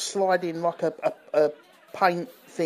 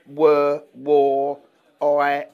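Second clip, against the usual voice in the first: lightly imitated Geordie vowels, slightly louder; imitating Geordie; 3 semitones lower, 6 dB louder, much slower than usual. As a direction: give each word a hint of Geordie. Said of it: "You say, like".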